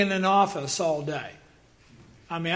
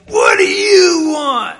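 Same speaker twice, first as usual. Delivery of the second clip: slow, loud, high hostile shout; a grumpy man yelling at the door; angry yell; 8 semitones higher, 10 dB louder, slower than usual. Retold or imitated. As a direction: bark it at the stranger on your doorstep. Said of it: "he was yelling at Brad".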